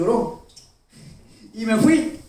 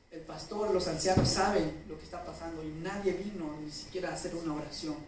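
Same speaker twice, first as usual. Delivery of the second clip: retold mother's words, much softer; mother's quiet words; 4 semitones lower, 11 dB quieter than usual. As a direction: quietly pass on mom's sad remark. Madre le habla, momento triste. (madre, en voz mucho más baja y triste)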